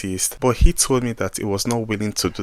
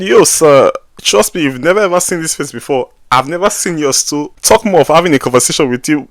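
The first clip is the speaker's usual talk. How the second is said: higher and louder, insisting; protesting; 4 semitones higher, 5 dB louder than usual. Retold or imitated. Insisting to the officer he has never met him. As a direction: push back playfully.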